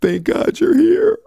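very high, slow tearful wail; drawn-out, loud acted sob; bursting into tears. A narrator's reading. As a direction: cry it out in overwhelmed relief. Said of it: "then burst into tears".

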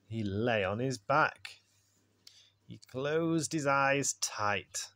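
put-on expressive read-aloud for a child, with pauses; bedtime story narration. A narrator's reading. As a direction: read a picture book at bedtime.

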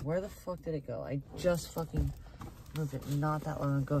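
usual speaking voice

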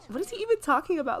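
high-pitched incredulous question, half laughing; mock-bored disbelief. Player voicing bored Sim at opponent's debate, amused disbelief. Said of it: "She's so bored".

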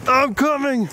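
high-pitched worried call. Real, not acted. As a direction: call out that help is on the way. Calling to stuck companion.

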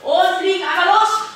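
high, loud, rising excitement; excited take-off call. Pretend. Coach sending pretend helicopters into the air, excited. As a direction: launch them like a helicopter.

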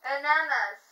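loud, slurred through a mouthpiece; wearing a mouthguard. Real, not acted. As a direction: shout past a mouthguard. Reading card with mouthpiece in.

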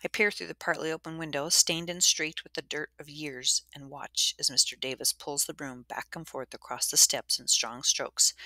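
ordinary delivery

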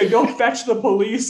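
high, loud laughing dare; mock shouted order. Master sending butler for police, mock shout. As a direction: join in the hammed-up command.

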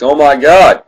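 shouted Oh my God; loud exclamation. Real, not acted. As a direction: blurt it out in shock. Shocked at tall crypt.

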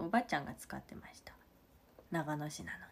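whispered confiding aside; hushed secret. Real, not acted. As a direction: lean in and whisper it. Secret aside about hometown.

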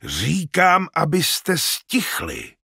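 high, forceful insistence; insistent. A narrator's reading. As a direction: press the demand, drawing out the end. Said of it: "trval na svém Ilja".

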